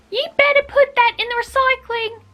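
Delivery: high-pitched put-on Australian accent; attempted Australian accent. Quick-fire film quote for viewers, high Australian accent. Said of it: "I'm so sorry, Australia".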